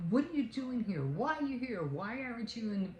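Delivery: high-pitched mock scolding questions; scolding demand. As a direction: imitate a boss telling off the maid. Doris scolds maid in retold quarrel.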